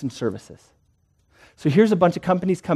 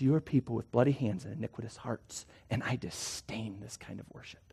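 much softer, lower, grave divine voice; voice of the Lord; 6 semitones lower, 15 dB quieter than usual. Acted. The Lord rebuking false worshippers, softer and lower. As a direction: let God speak in a hushed, heavy rebuke. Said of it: "the Lord looks at it the whole time and says".

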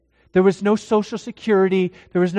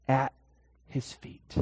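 whispered ending; 5 semitones lower, 8 dB quieter than usual. Acted. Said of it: "softened climax".

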